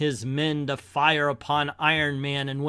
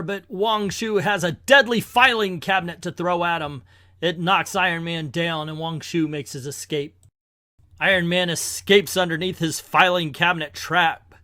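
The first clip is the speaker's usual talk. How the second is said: ordinary delivery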